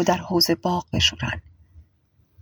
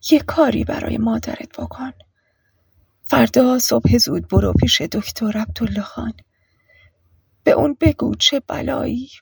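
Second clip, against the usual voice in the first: higher, whispery request; under the breath; 4 semitones higher than usual. A narrator's reading. Mother sending son for doctor, under her breath. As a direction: ask it in a weak half-whisper. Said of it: "زری ادامه داد".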